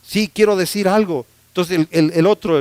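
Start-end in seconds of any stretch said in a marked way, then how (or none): none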